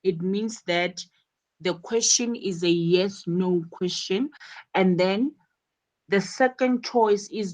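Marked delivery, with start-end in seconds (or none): none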